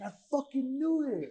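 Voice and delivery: high loud triumphant cry; thrilled stranger. Retold or imitated. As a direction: crow it like you called it.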